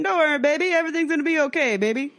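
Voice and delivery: lightly voiced stranger calming a drunk girl; Daryl soothing. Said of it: "with Daryl going".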